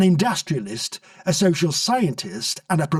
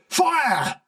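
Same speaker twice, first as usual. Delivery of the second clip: sharp high order to fire; officer snapping a high command; snapped command; 11 semitones higher than usual. A narrator's reading. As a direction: snap the order out, pitched high. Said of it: "snapped Loosely Bravington".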